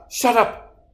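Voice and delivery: high, sudden cut-in; angry outburst. Acted. Killer told she is a fraud, angry outburst. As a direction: lash out to silence an insult.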